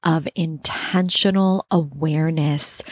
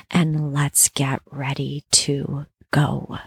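guided sleep meditation. Acted. Leads Yoga Nidra sleep meditation.

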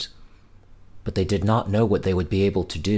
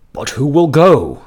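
Wolf; 4 semitones higher, 8 dB louder than usual. Acted. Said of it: "said Wolf".

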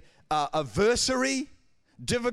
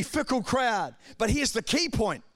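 ordinary talk

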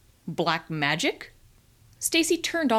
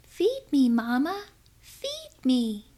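put-on high, little baby voice; tiny baby voices; 5 semitones higher, slower than usual. A narrator's reading. Babies in cribs call to their mothers, tiny voice. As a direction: plead like a tiny hungry infant. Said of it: "tiny little voices rose up".

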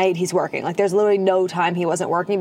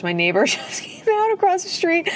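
higher voice breaking into a laugh; amused laughing; 9 semitones higher than usual. Real, not acted. Host spotting her neighbor across the street, laughing. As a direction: giggle through the sudden interruption.

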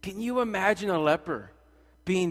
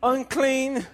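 mock leper cry; 5 semitones higher, 4 dB louder, slower than usual. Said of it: "It's like".

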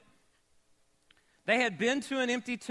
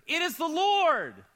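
awed exclamation; 9 semitones higher, 6 dB louder than usual. Acted. John's awed recognition.

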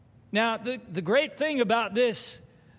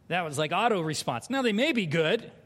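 ordinary talk

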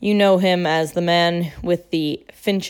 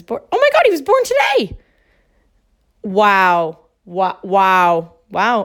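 excited; 5 dB louder than usual. Real, not acted. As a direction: burst out with surprised joy. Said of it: "Wow, that was really exciting".

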